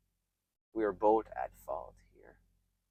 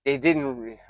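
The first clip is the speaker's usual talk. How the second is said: ordinary talk, nothing marked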